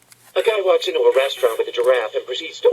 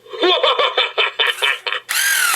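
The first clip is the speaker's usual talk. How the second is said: long, high, staccato laugh; hearty laugh; 13 semitones higher than usual. Acted. Joker cracks up at own chicken joke, long laugh. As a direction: burst into a long cackling laugh.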